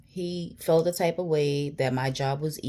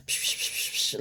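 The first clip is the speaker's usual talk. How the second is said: repeated voiceless "psh" bursts; imitated rushing noise; 4 dB quieter than usual. Showing questions flooding her head, a string of imitated whooshes. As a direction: puff out a string of "psh" sounds. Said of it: "mad questions just was like".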